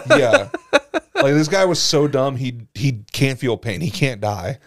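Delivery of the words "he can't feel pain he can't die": ordinary talk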